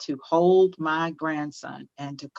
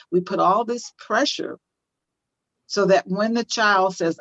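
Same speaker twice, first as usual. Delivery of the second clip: ordinary talk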